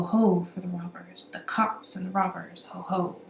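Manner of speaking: usual speaking voice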